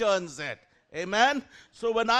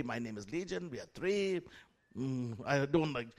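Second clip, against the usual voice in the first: softer, lower mock demon voice; imitating demon voice; 5 semitones lower, 7 dB quieter than usual. Preacher recalling the demon's words, quietly low. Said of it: "she was talking very clearly".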